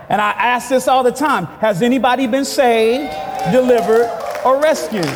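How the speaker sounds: high, forceful preacher's voice; impassioned preaching. Real, not acted. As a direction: drive it home to the congregation. Preacher calls on congregation.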